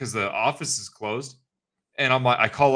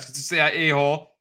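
normal talking voice

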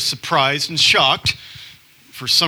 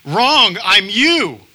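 high, louder mock comic reveal; 7 semitones higher, 4 dB louder, much slower than usual. Comic to the audience, a high, louder mock reveal. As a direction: snap back a punchline. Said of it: "And he's like".

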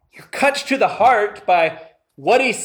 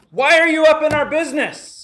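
fast, higher, louder paraphrase of the defiant demon; demon's retort; 7 semitones higher, 4 dB louder, faster than usual. Retold or imitated. Preacher paraphrases the demon confronting Jesus, quick indignant snap. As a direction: toss it off as a cheeky challenge. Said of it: "he's saying".

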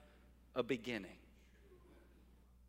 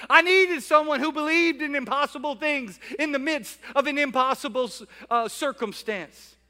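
grateful voice; 6 semitones higher, 5 dB louder than usual. Acted. Preacher voicing a grateful person.

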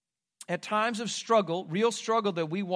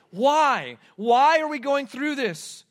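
anguished why; 8 semitones higher, 8 dB louder, slower than usual. Acted. Preacher voicing the cry.

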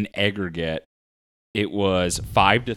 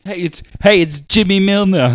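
put-on high, loud English-to-New York accent slide; mixed-up accent; 9 semitones higher, 8 dB louder than usual. Friend playing the footballer, English accent sliding into New York. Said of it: "the English accent to the New York".

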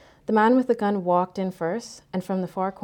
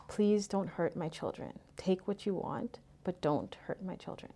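hushed pleading; 10 dB quieter than usual. A narrator's reading. Mother to the robber about her children, hushed pleading. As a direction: beg quietly, sinking softer at the end.

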